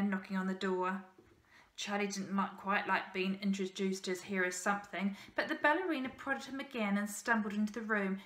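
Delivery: ordinary delivery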